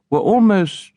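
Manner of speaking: plain reading voice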